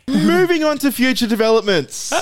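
high, loud-ish mock-impatient announcement; exasperated moving on. Cutting off teasing about pronunciation.

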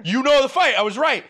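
high, loud appeal to the listener; indignant insistence. Real, not acted. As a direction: press the point, high and forceful. Insisting they won the sibling argument.

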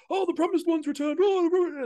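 high, cheering townsfolk call; mock cheering crowd. Imitating villagers cheering the hero back home, high and excited. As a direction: hail the returning hero like a crowd.

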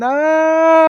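high, loud mock scream; long hammed-up "aah"; crazed scream. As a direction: imitate a man screaming in panic. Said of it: "Greg's going crazy, saying".

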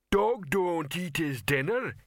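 slow, high-pitched lumbering troll; slow dim-witted troll voice. Acted. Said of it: "came Detritus's voice".

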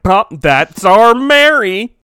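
big hammy catchphrase delivery; sitcom catchphrase. Acted. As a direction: stretch it out like a show title. Sitcom title line, drawn out.

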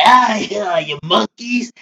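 high sneering villain voice; mock the villain, high and sneering; Frieza. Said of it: "That man was like".

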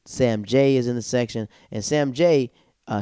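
ordinary talk, nothing marked

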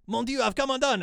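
put-on very high, loud French-accented wail; hammed-up French hero crying out in despair; appalling French accent. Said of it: "my French accent is appalling".

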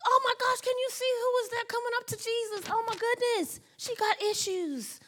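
high, gossipy mock scandal; whisper-gossip about her, amused; gossiping onlookers. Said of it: "Everybody else around her thought she was crazy".

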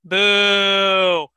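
loud playful boo at a bad pun; groaning boo at the pun; mock boo. Real, not acted. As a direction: boo the joke, teasing. Booing a pun.

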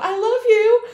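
high, sing-out mock lovey voice; gushing declaration. Girl hugging Buddy as they part, in mock gushing. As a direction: coo it high, as a parody sweetheart.

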